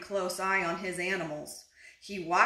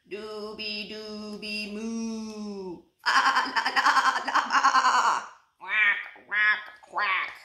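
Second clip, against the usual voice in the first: animal chorus; slower than usual. A narrator's reading. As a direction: sing it like barnyard animals. Animals singing heard at fair.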